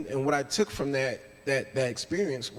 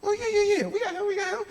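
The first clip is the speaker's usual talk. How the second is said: much higher and louder, cheering; hyped-up teammates; 5 dB louder, faster than usual. Retold or imitated. Teammates suddenly cheering for the player they once passed over. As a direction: yelp it like the whole court just got excited. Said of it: "They're like".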